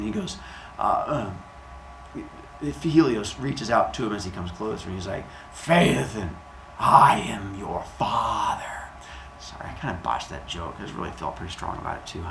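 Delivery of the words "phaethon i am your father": slow, hushed movie-villain parody; dramatic father reveal. Acted. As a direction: drag it out portentously, ending in a whispery voice. Joke father reveal by the god.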